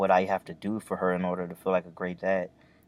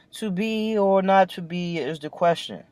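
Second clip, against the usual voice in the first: high, grand theatrical stage voice; dramatic Shakespeare recital; 10 semitones higher than usual. Pretend. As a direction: declaim it like an old stage actor. Quoting Shakespeare, then asks who said it.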